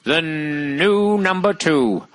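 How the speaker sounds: drawn-out, very slow announcement; slow, ominous-style intro mimicry; TV intro impression. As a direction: stretch each word out like an old TV voice.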